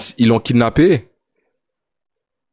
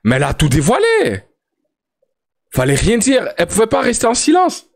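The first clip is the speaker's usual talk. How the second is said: outraged disbelief; 8 semitones higher than usual. Real, not acted. Outraged reaction to sister testifying.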